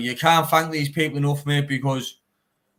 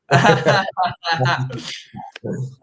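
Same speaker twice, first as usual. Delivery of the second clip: real hearty laugh after a joke; a loud, real burst of laughter; 2 semitones higher, 5 dB louder than usual. Real, not acted. Laughs after joking about keeping flag.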